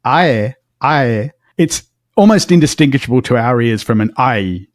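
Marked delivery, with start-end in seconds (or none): 0.1-1.3 "ae" sliding from ah to air; diphthong demo. Shown as a demonstration. Showing how ae nearly matches I. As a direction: glide "ae" from ah into air, slowly. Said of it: "start with an R and end in an air".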